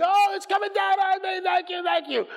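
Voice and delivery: high-pitched hammed-up wailing cry; mock ecstatic screaming. Preacher imitating the crowd at Fatima, mock screaming in rapture. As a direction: imitate a frenzied worshipper crying out. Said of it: "weeping, yelling, screaming".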